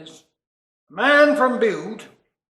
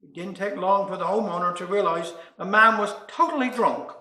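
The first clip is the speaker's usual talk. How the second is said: normal talking voice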